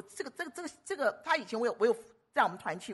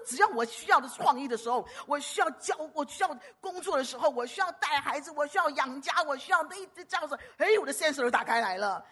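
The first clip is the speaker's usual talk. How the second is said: higher, louder eager pleading; eager retold prayer; 6 semitones higher, 5 dB louder than usual. Sister praying to God for her sensor: eager, higher pleading. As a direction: voice her bold request to God.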